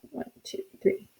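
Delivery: very soft count to yourself; barely voiced, private counting; counting under breath. Real, not acted. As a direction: count it out almost silently. Counting to herself while checking.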